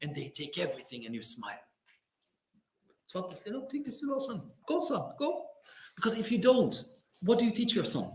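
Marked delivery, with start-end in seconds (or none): 3.9-5.3 gentle father. Retold or imitated. Father voiced telling son go.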